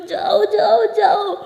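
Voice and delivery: crying shout. Acted. Pari screaming at her partner to get out, tearful shout. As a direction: scream at her to leave through tears. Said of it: "बहुत ही ज़्यादा shout करने लगती है".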